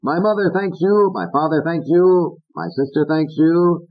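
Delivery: high singsong thank-you routine; hammed-up stage thanks; Pat impression. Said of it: "Pat said".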